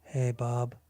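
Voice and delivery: low, soft mock-downcast voice; pretend sad caller. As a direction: fake being glum, low and quiet. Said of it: "Why are you so sad?".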